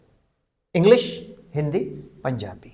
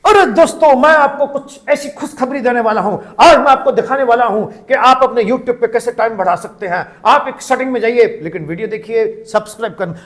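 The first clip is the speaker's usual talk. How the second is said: higher-pitched, bubbly video intro patter; high-energy YouTuber; 3 semitones higher than usual. Acted. Trainer imitating YouTubers who greet their viewers, high-energy patter. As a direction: gush at your subscribers without a pause. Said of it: "इतनी energy से बोलेंगे".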